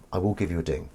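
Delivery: ordinary talk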